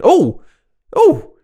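loud high gasping Oh; shocked exclamation. Real, not acted. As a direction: react in astonishment. Reacting to a goal clip.

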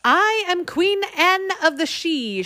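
high, slow, bell-like queen; high clear voice. A narrator's reading. Fairy queen introducing herself, high and clear. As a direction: ring out like a bell. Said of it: "high, clear voice rang like a bell".

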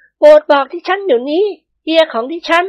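high, anxious demand; trembling wife. A narrator's reading. Nuanla-o begs for news of her husband, trembling. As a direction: plead urgently in a high female voice. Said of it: "นวลละออพูดเสียงสั่นๆ".

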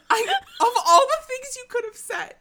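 high, loud laughing words of disbelief; cry-laugh through it; laughing, high incredulous formula one. Real, not acted. Laughing disbelief at the show choice.

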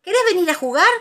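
high, loud playful invitation; eager Tiger. A narrator's reading. Tiger inviting Pooh to play, eager. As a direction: ask like an excited playmate.

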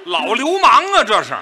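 loud, high cry of mock outrage; comic yell of disbelief. Real, not acted. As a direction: shout it out, scandalised. Partner mock-outraged at the story.

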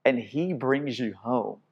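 quiet, hushed, tender invitation; soft closing appeal. Acted. Preacher gently inviting the congregation to come home, hushed.

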